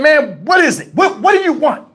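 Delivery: high-pitched, exasperated retold demand; pissed off. Driver to officer at the car window, pissed off. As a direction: act out an irritated driver challenging a cop. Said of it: "I was just pissed".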